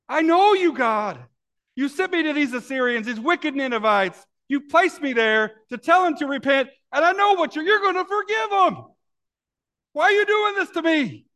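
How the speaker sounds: high, loud, strained complaint to God; exasperated Jonah. Retold or imitated. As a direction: shout it up at God, fed up and accusing. Preacher voices Jonah complaining to God.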